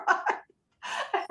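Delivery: airy high laugh with no voice behind it; a wheezy unvoiced chuckle on right; breathless silent laugh. Real, not acted. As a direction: laugh soundlessly at the absurdity. Laughing at the school's gender split.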